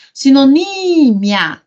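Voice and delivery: sinoNÍÍÍmia, NÍ loud and long; calling the word. Shown as a demonstration. Teacher finding the tonic syllable, calling the word. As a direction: call out sinonímia, holding the NÍ.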